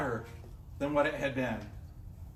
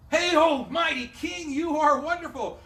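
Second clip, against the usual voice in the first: mock fawning shout; 8 semitones higher, 4 dB louder than usual. Snitches flattering the king.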